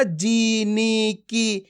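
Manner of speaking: जी, नी, की each stretched into a long "ee"; drawn-out vowels. Shown as a demonstration. Teacher shows students the mistake to avoid, जी नी की drawn out. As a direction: drag out the vowel of जी, नी and की slowly. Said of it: "खींच खींच के नहीं करना है".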